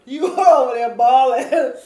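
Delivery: loud laughing tease. Real, not acted. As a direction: laugh loudly while teasing. Laughing at friend crying.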